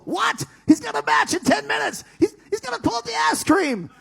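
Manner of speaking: high, loud, frantic yell; panicked shout. Retold or imitated. Panicking about Booker T before the show, high and loud. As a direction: shriek it out in full panic.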